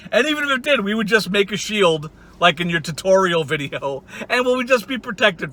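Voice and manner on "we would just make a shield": ordinary talk, nothing marked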